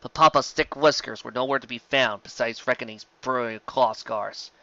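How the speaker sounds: plain reading voice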